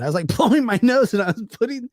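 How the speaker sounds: fast, breaking into laughter; laughing. Retold or imitated. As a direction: rattle it off while cracking up. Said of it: "He's like".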